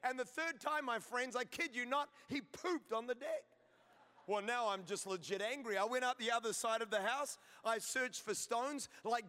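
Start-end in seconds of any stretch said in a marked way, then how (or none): none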